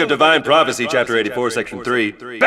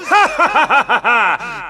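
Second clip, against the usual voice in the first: gloating laugh; 6 semitones higher than usual. Acted. Pyron gloating in battle.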